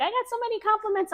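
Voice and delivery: quick, high-pitched excited gush; delighted client. Retold or imitated. Client gushing to the nail artist about compliments, delighted. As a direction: imitate a thrilled client, rushing it.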